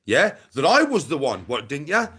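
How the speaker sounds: ordinary delivery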